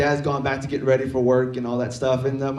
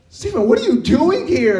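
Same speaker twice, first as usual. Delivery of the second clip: high-pitched, astonished parent voice; startled parent; 11 semitones higher, 4 dB louder than usual. Acted. Parents find their son in the living room, startled. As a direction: call out in shrill disbelief.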